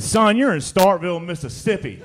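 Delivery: high-pitched exclamation as the officer; policeman punchline. Acted. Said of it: "there was a little Italian guy there".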